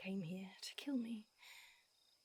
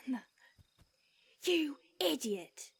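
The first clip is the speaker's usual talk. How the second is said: louder whispered snap, slowed on idiot; angry outburst; 4 semitones higher, 8 dB louder, much slower than usual. Acted. Sire scolding the fledgling who spoiled the hunt, angry whispered outburst. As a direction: lash out at the clumsy pupil.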